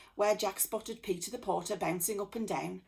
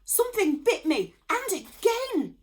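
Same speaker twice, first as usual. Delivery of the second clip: high, loud, panicked yell; yelling in pain; 13 semitones higher, 9 dB louder than usual. A narrator's reading. Anorak yelling after being bitten again, high loud. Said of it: "yelled Anorak".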